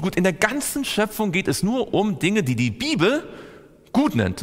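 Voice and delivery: fervent sermon delivery; preaching. Acted. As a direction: preach it to the room, building on each gut. Preaches the creation refrain.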